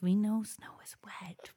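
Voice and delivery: unvoiced stage whisper. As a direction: whisper it as an aside. Joking aside to listeners.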